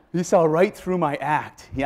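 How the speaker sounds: usual speaking voice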